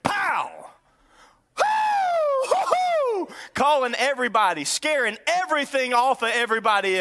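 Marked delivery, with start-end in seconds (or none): 0.0-0.7 mock rifle "pow"; punchy one-syllable gun noise; imitated gunshot. Imitates a rifle shot at deer. 1.5-3.3 high hooting whoop; long, high-pitched "hoo" calls; celebratory whooping. Retold or imitated. Mimics hunter whooping after shot.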